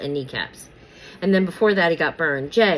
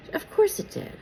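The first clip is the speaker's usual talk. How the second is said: whispered, dry aside; sarcastic whisper. Real, not acted. Vlogger mutters a cynical aside to viewers, whispered. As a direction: roll your eyes and breathe it out, high.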